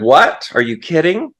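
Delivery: rising, disbelieving questions; acted incredulity. Man on the branch answers God, mock incredulous. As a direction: balk at an absurd order, pitch climbing. Man balks at God's order.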